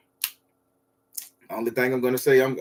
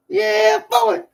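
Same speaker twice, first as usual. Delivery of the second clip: hype shout; 5 dB louder than usual. Real, not acted. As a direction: holler it up high. Hype shout after thanks.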